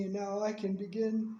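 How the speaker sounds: usual speaking voice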